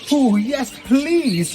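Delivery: high, eager little squeak. A narrator's reading. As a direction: squeak it out with delight. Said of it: "squeaked Jojo".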